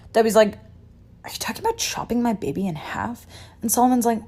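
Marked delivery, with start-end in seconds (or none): 1.2-3.3 hushed, horrified question; appalled whisper. Retold or imitated. Debbie questions King Solomon's plan, appalled whisper. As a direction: whisper it in disbelief. Mother appalled at king's hint.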